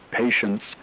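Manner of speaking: plain reading voice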